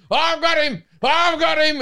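high, loud triumphant cry; Steve Irwin triumph. Retold or imitated. Wrestling the escaped snake out of the drawer, triumphant shout. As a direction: yell it like you just caught a croc. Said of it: "I became very Steve Irwin".

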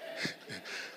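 soft unvoiced chuckle; quiet amused laugh; breathy laugh. Real, not acted. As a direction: let a quiet laugh slip out. Laughs at own point.

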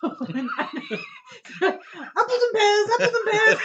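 high, loud jaunty chant through laughter; trotting downstairs. Pretend. Player pretends to trot down the stairs, giggly jaunty chant. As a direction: chirp it as if trotting down steps. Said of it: "As you're, like, trotting down the stairs".

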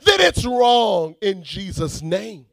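loud, high preaching prayer; shouted declaration to God; fervent prayer. Real, not acted. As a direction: pray it out at full force.

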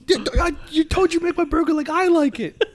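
high put-on Boston guy voice; tough Boston guy. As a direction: whine back like a Boston customer. Answering the voiced burger boss.

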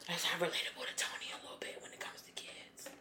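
whispered confession; whispered aside. Real, not acted. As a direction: breathe it out as an aside. Quiet aside.

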